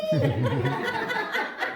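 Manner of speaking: high loud burst of laughter; laughing at the failed plea. Real, not acted.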